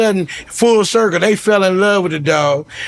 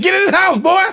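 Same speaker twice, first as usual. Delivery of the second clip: urgent order; 11 semitones higher, faster than usual. Retold or imitated. Father ordering son inside.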